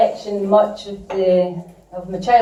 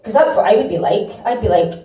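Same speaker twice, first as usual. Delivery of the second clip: ordinary delivery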